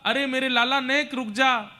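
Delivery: put-on high, urgent mother calling after her child; pleading call. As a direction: call out, begging him to stop. मैया दौड़कर कान्हा को पुकारती. (ऊँचे सुर में व्याकुल पुकार)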